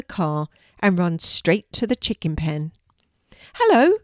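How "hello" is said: high, loud call from the barn; shouted hello. A narrator's reading. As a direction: call out a greeting across the yard. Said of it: "shouts a voice".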